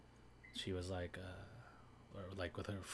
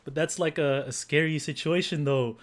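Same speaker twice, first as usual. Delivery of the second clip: much higher, louder fast host banter; hammed-up Sanma host; 8 semitones higher, 10 dB louder than usual. Impersonator as Sanma teasing Nagahama, loud and high. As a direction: egg her on like a TV comedian. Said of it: "Sanma, he's like".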